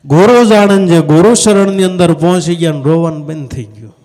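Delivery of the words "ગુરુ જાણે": much higher and louder proclamation; emphatic. Acted. Preacher driving the point home to listeners, loud. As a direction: hammer home that the guru knows. Repeated with force.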